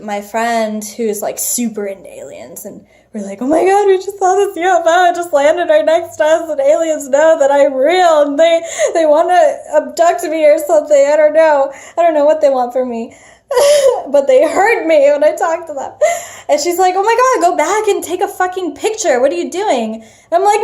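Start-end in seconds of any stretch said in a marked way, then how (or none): 3.4-15.9 high, loud, fast near-tearful babble; retold frantic panic. Speaker phoning friend who's super into aliens, frantic panic. 17.0-20.0 higher, hurried, incredulous command; retold excited urging. Friend on the phone ordering them back, excited urging.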